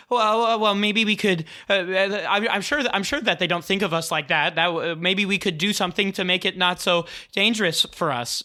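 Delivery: stammering, hurried nervous plea; fretful, rushing to reassure; anxious. Acted. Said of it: "Anxious Leo".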